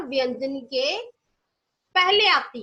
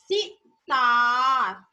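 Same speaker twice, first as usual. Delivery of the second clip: slow model word; 3 semitones lower, 4 dB louder, much slower than usual. Shown as a demonstration. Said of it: "बोलो".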